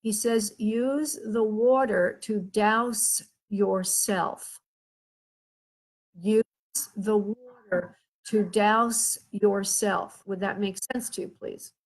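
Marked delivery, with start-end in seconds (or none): 0.6-4.6 each word laid down slowly and deliberately; slow, weighty relayed message; slow emphatic instruction. Retold or imitated. Relaying spirit's instruction, repeated slowly. 6.2-10.2 each word laid down slowly and deliberately; slow, weighty relayed message; slow emphatic instruction. Retold or imitated.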